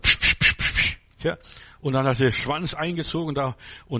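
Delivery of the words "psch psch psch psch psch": unvoiced psch sounds to scare off a scorpion; shooing hiss. Preacher retells shooing the scorpion in Lithuania, hissing. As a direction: hiss sharply, chasing it off. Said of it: "habe ich nur gesagt".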